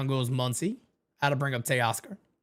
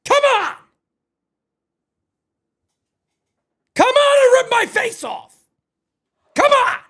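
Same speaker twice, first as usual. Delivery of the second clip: yelling at TV; 10 semitones higher than usual. Real, not acted. Yelling at the TV show.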